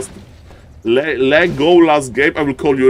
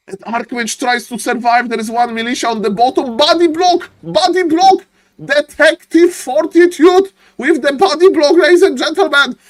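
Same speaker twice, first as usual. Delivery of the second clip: excited play-by-play; 13 semitones higher than usual. Real, not acted. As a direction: shout the big play to the crowd. Live esports play-by-play.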